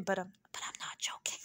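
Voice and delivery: low, hushed whisper; whispered aside. Real, not acted. Vlogger taking back her joke to viewers, whispered aside. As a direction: whisper it quickly like a confession. Mock-confidential aside after joking.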